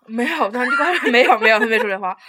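loud, laughing protest; laughing denial. Real, not acted. Denying a friend's "I love him" joke, laughing loudly. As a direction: bat the joke away through real laughter.

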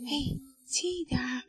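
hushed. A narrator's reading. Mother hushes children near sleeping baby, speaking softly. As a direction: keep it down, baby is asleep. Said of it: "熊妈妈小声说".

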